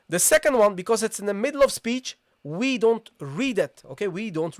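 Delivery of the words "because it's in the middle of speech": ordinary delivery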